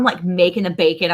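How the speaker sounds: normal talking voice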